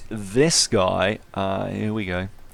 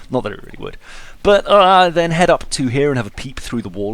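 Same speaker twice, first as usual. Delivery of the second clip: ordinary talk, nothing marked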